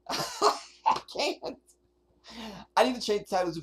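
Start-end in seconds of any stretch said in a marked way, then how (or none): 0.0-1.6 laughing. Real, not acted. Laughing at the bullshit.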